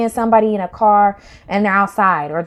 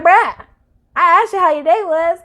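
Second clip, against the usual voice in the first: higher, exasperated mock protest; higher, put-out retelling; exasperated bruh; 7 semitones higher than usual. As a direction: play the fed-up partner. Acted exasperated partner.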